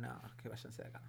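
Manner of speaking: quick, hushed brush-off; dismissive executive. Retold or imitated. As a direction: wave them off like a bored boss. Fox executives rejecting the pitch.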